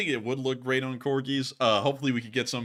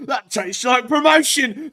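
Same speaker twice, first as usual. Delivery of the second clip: mock Russian soldier; 11 semitones higher, 10 dB louder, slower than usual. Joke punchline in Russian voice.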